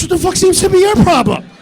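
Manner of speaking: loud mock-outraged rant; shouted comic rant. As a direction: yell it at the crowd, fed up. Ranting at women in crowd.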